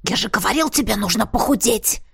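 exasperated hissing reproach; hissed. A narrator's reading. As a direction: hiss it at your brother. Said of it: "прошипел Лин". (раздражённо прошипеть)

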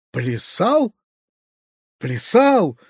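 restrained horrified echo, question then exclamation; horrified cry. A narrator's reading. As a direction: echo it in horror, without much volume. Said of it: "вскрикнул в ужасе дядя". (сдержанный возглас ужаса)

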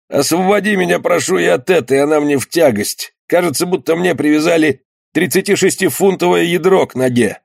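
lightly voiced suffering sailor; wounded skipper, pleading. A narrator's reading. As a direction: beg relief from a heavy leg.

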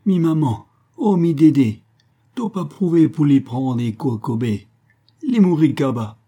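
put-on high, loud, wailing old Creole man; desperate cries. A narrator's reading. Old leper cries for help over the dying man, high desperate wail. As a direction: cry out in despair, voice cracking high. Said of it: "poussait des cris désespérés".

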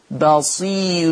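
very slow بصير with the راء made thin and light; thin raa. Shown as a demonstration. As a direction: stretch بصير and soften its راء. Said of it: "ترقق". (بصير بترقيق الراء، ببطء)

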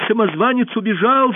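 high, loud cry of alarm; shouted news. A narrator's reading. Pursuer running into the hall, shouting news. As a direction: burst in crying out the news. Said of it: "закричал он".

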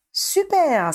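high exclamation with a hint of irony; faux enthousiasme. A narrator's reading. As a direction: cheer it, trying to hide the sarcasm. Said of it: "d'une voix qu'il espéra ne pas être trop ironique". (exclamation faussement enthousiaste, à peine ironique)

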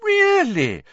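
high exaggerated "Really?"; mock interest. A narrator's reading. Rita faking lively interest to Hagrid, high and loud. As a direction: gush with put-on surprise.

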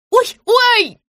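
high-pitched "ouch"; pained yelp. A narrator's reading. Woman pinched by the belt during a call, yelping. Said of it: "подпрыгнула я".

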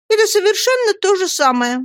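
high-pitched petulant retort; high cry. A narrator's reading. Dora rejects husband's excuse, high cry. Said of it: "крикнула Дора".

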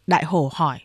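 ordinary delivery, nothing marked

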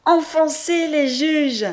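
loud, high, drawn-out cheer; triumphant cry. A narrator's reading. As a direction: crow over the beaten judges. Triumphant cry after release.

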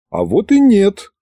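high, drawn-out condescending rebuttal. A narrator's reading. Scientist corrects the demon, condescending. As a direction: contradict him from above, slow and superior. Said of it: "учёный снисходительно посмотрел на беса".

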